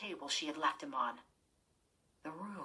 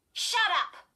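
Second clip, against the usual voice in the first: high, loud angry snap; snapped; 13 semitones higher, 10 dB louder than usual. Acted. Girl snapping at her mocking sister, high and loud. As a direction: cut her sister off sharply. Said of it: "Chris snapped".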